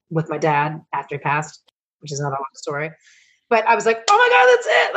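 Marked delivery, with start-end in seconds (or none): 4.1-4.9 much higher, louder burst of joy; excited exclamation. Retold or imitated. Reliving to Robbie the moment her dad's sign came, high excited shout.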